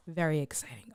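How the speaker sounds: soft whispered aside. Real, not acted. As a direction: breathe it almost silently.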